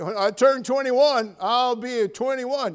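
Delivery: loud, high, drawn-out mimicry; mock teenage boast. Preacher imitating a teenager longing for adulthood, loud mocking boast. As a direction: crow it slowly and loudly like a cocky youngster.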